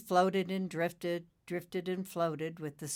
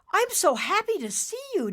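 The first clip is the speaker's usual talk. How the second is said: very high, loud happy shout; joyful shout; 8 dB louder than usual. Acted. Nimbus greets his father at last, joyful shout. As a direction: shout for joy. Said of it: "Nimbus shouted".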